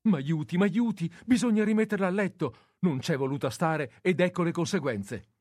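high, loud desperate pleading; frantic plea. Acted. Nurse begs the young man for help, loud and frantic. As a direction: beg for help, near panic.

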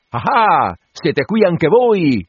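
voce alzata e lenta del curato; il curato scopre l'assistente alle spalle; curato sorpreso. A narrator's reading. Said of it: "gli disse". (curato stupito, voce più alta e lenta)

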